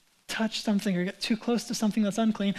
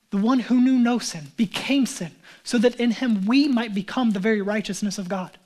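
preaching; 5 dB louder than usual. Acted. Preaching climax.

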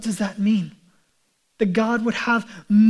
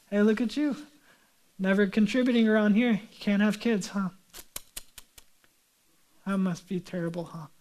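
mock taunting; 4 dB quieter than usual. Rival wife pokes at a childless woman, mildly mock taunting. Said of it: "she would taunt her".

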